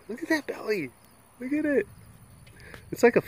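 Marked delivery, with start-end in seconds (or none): 0.1-1.9 high cooing voice. Real, not acted. Cooing at the cat.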